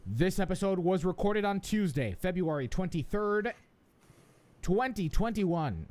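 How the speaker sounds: polished announcer delivery; lifted, projected host read, drawn-out ending; podcast intro read. Real, not acted. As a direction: announce the show to the listeners. Reads the show's intro.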